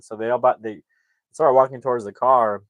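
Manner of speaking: ordinary talk, nothing marked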